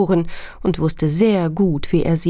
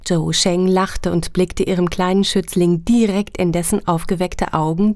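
ordinary delivery

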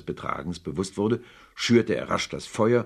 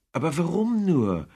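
baffled cry; 11 semitones higher, 5 dB louder than usual. Acted. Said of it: "rief Scrooges Neffe".

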